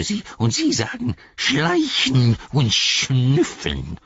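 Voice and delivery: whiny, wheedling creature, hurt and sly; slow, offended creature whine; Gollum voice. A narrator's reading. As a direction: grovel and sulk, squeaking up on jawohl.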